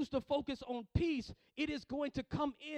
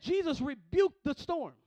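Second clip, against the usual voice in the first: urgent cry; 4 semitones higher, 9 dB louder than usual. Acted. Disciples wake Jesus in storm.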